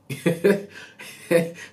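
breathy burst of laughter; high airy laugh. Real, not acted. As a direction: laugh out at your own story. Laughs at the shaking.

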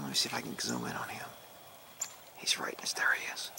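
near-whisper so the bird stays put; softly, barely voiced while filming; hushed. Real, not acted. Quietly narrating the cardinal on camera to viewers, hushed.

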